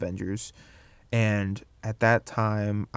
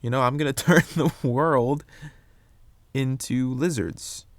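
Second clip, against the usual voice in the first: mock comic-book villain; 3 semitones higher than usual. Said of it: "it's a very comic book plan".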